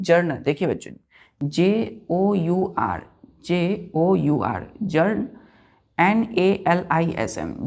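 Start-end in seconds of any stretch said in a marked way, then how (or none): 1.4-7.7 each letter spaced out slowly for dictation; teacher spelling a word slowly so children write it down. Shown as a demonstration. Teacher dictating letters to children, slow and spaced. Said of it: "इसका pronunciation क्या करते हैं?".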